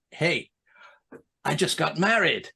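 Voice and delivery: a light American showman "Hey, I just got married"; mock American stand-up. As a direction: announce "married" like a proud comic's punchline. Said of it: "they were all saying".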